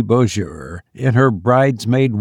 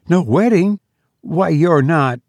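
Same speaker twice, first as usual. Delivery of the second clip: high, rising incredulous question; pitched-up astonished protest; startled disbelief; 6 semitones higher than usual. A narrator's reading. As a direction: echo it in surprise, then break off. Hagedorn hears wedding is off.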